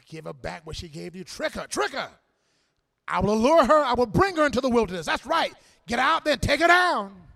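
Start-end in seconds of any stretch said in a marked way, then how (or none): 1.2-7.3 loud, rolling preacher's cadence; preaching at full force. Real, not acted. Preacher rousing the congregation about healing the outcasts, full-force preaching. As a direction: preach it out to the whole church.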